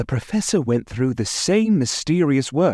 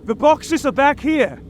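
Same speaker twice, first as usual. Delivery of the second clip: excited shout. Acted. Harker calling to the others on finding boxes, excited shout. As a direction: call it out as you find them. Discovery during the hunt.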